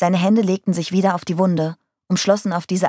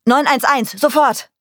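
high, loud emergency shout; urgent bellow; 8 semitones higher, 5 dB louder than usual. A narrator's reading. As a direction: bellow it like a frantic order. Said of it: "Brüllte Mike". (dringend gebrüllt)

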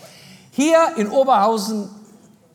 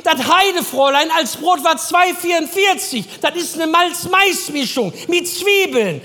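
hoch, etwas lauter gerufen; leicht gedehnt von hinten rufen; Helga von hinten; 6 semitones higher than usual. Retold or imitated. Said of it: "Helga von hinten". (ruft von hinten, etwas höher)

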